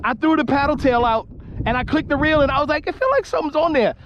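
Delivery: fast, breathless retelling; rapid excited recap; excited rush. Real, not acted. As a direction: tumble the story out, still buzzing.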